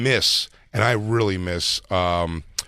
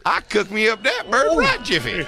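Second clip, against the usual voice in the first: put-on high old miner voice; hammy mountain man twang; prospector voice; 10 semitones higher than usual. Voicing mascot Sourdough Sam.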